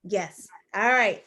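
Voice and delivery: ordinary talk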